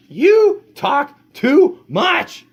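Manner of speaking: slow, loud complaint; fed-up yell. Acted. Father yelling at the dog, loud and slow. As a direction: bark it out, each word slow. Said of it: "yelled Father".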